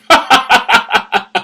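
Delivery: long string of high, even ha-has; high rattling cackle; staccato laugh. Real, not acted. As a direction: laugh in a rapid steady rattle. Laughs at own joke.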